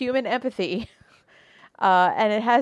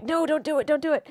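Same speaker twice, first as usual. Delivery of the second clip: mock pleading; 7 semitones higher than usual. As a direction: beg a TV character, half in earnest. Pleading with a TV character.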